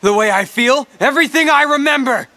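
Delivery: rising despair, shouting your own fakeness; anguished shout building in pitch and force; anguished outburst. Replica's bitter outburst.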